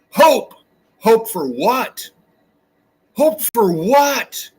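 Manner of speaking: high, slow scornful Hope for what; incredulous, drawn-out contempt. Real, not acted. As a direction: drag it out, mocking the idea of hope. Mocking hope, rising scorn.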